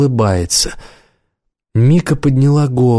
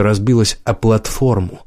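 plain reading voice

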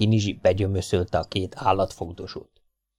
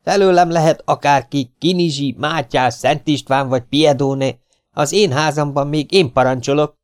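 higher, louder, defiant woman's voice; defiant housewife; 6 semitones higher, 4 dB louder than usual. Acted. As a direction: put him in his place in your house. Hollóné stands up to Kinizsi. (magas, dacos, harcias asszonyhang)

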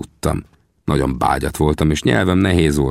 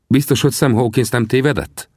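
lightly voiced, higher question; doubting Winnetou; 7 semitones higher than usual. A narrator's reading. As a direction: query the report with some doubt. Winnetou questions Sam's report.

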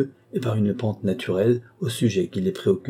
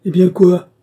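much higher and louder, a brash insolent shout; insolent retort; 10 semitones higher, 10 dB louder than usual. A narrator's reading. Carquebue talks back to the marquis and Clémence, insolent shout. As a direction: fling it back at them, insolent. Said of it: "répondit insolemment".

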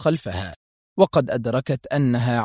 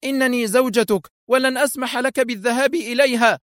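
very high, insistent protest; protesting cry; 11 semitones higher than usual. A narrator's reading. Wife forbids husband going to her rival, protesting. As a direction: refuse in a high-pitched outcry. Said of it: "صاحت في احتجاج".

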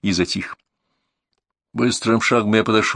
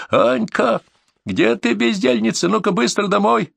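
put-on high-pitched mother summoning her child; woman calling; 11 semitones higher, slower than usual. A narrator's reading. Mother calls idle daughter home, high scolding call. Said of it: "женский голос позвал".

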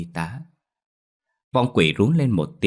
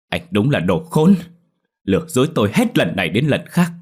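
louder, angry accusation; furious; 3 semitones higher, 6 dB louder than usual. A narrator's reading. Ghost turns on the lying brother, loud and furious. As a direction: spit the insult at him, loud. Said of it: "tức giận".

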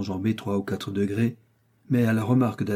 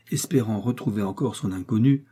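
usual speaking voice

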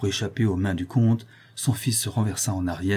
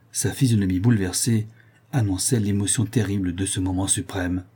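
ordinary delivery, nothing marked

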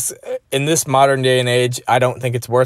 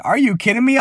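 disbelief; 9 semitones higher than usual. Retold or imitated. Shocked by the baby post.

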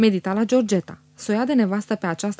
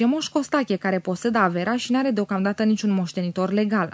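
plain reading voice

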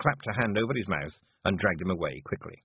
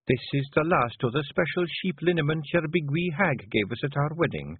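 higher, gently chiding Scots wife; Scottish wife; 7 semitones higher than usual. Acted. Wife creeping up on her brooding husband, gently chiding. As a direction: speak as a concerned Scottish wife. Voices Jeanie.

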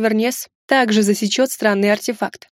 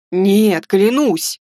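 emphatic, lightly raised protest; exclaimed denial; 3 dB louder, much slower than usual. Acted. Hermit denying he knew about the mask, exclaiming. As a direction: swear it emphatically. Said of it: "воскликнул отшельник".

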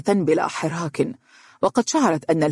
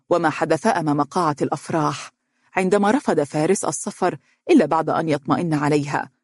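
usual speaking voice

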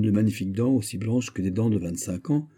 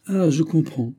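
a higher cry of understanding; sudden realization; 8 semitones higher, 4 dB louder than usual. Acted. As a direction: exclaim as it dawns. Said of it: "s'écria D'Artagnan". (s'écrie, plus aigu, en comprenant)

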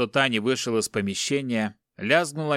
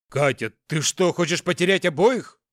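agitated reproach; 6 semitones higher, 4 dB louder than usual. A narrator's reading. Husband argues with desperate wife.

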